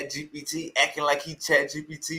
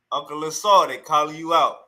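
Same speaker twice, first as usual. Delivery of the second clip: ordinary talk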